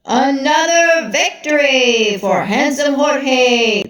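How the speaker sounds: slow, drawn-out ring announcer call; announcer hypes the win, slow and showy; announcer cry. Acted. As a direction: stretch every word for the crowd. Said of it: "the announcer cried".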